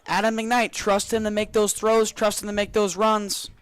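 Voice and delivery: usual speaking voice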